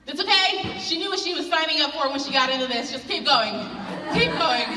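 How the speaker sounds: high, loud mom impression; mom's voice. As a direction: play the mom cheerfully brushing it off. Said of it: "my mom said".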